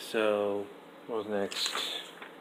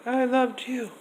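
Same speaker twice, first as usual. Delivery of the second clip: higher, louder, overblown confession; mock tearful Nia; 13 semitones higher, 4 dB louder, slower than usual. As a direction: ham up a dramatic I loved you. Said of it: "Nia said".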